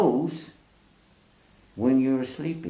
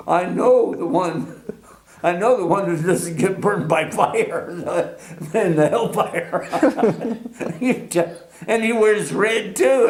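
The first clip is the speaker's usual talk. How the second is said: higher, giggling through the words; laughing aside; 6 semitones higher, 4 dB louder than usual. Retold or imitated. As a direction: chuckle at the devil joke. Laughing thought about devil.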